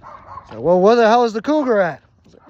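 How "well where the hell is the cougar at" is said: high, loud mock-angry question; indignant demand. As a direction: demand it like an exasperated chief. Chief demands the cougar.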